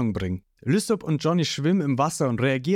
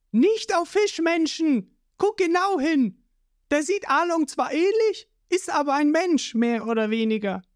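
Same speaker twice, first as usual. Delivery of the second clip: Nojiko scolding; 13 semitones higher than usual. A narrator's reading. Nojiko stops the boy.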